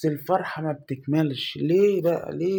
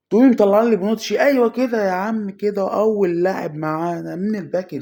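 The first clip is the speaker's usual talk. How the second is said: higher, loud, thrilled shout; excited cheering; 5 semitones higher, 7 dB louder than usual. Real, not acted. Gamer cheering his first pack player, loud and high. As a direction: celebrate the pull out loud.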